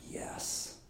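a private little victory; whispered yes. Retold or imitated. After finally getting booked, whispered yes. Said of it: "I was like".

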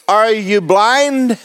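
slow, drawn-out incredulous question; incredulous older lady. Retold or imitated. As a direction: stretch it out as an older lady's mock retort. Older woman's retort, acted.

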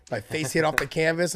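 normal talking voice

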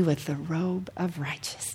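scripture fading to a whisper. Acted. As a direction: trail into a whisper.

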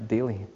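"Daily." set apart alone, falling; single-word emphasis on "Daily."; stressed word. Shown as a demonstration. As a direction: lean on "Daily." as its own sentence. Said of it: "Let's underline that word".

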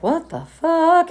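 high, slow, drawn-out disbelief; stunned disbelief. Retold or imitated. Hearing her old rival wants an interview, slow high disbelief. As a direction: let the shock stretch every word.

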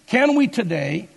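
normal talking voice

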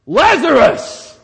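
sudden high, drawn-out shout; loud cry. Acted. Preacher acts out Jesus calling into the tomb, loud cry. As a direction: cry it out at full volume. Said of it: "he cried out with a loud voice".

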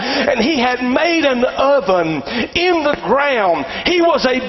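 high, emphatic testimony telling; climactic preaching. Real, not acted. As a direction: build the miracle story from the pulpit.

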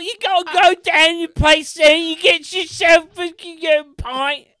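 bad British accent. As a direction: shout garbled fake-British directions. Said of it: "that is what he sounds like".

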